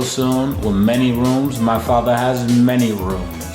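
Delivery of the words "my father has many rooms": normal talking voice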